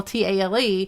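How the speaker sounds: spells out T A L E letter by letter; spelled out. Shown as a demonstration. Spelling T A L E to clear up the pun. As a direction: say each letter of "T A L E" separately. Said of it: "he's gonna tell a long tale".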